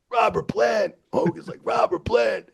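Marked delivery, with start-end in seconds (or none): 0.1-0.9 very high, mock excited yell; starstruck cry. Wrestlers reacting to a rock star backstage, starstruck. 1.6-2.5 very high starstruck exclamation; Hogan. Retold or imitated. Wrestler amazed by a rock star backstage, starstruck.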